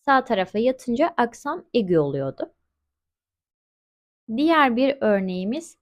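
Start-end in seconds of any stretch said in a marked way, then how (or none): none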